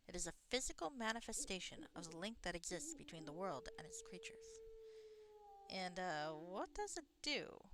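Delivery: put-on soft, lightly accented reading of the game's lines; lightly voiced game dialogue. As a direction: read the druid's lines softly with a hint of an accent.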